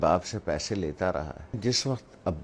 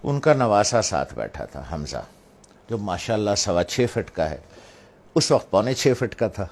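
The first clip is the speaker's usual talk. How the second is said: ordinary talk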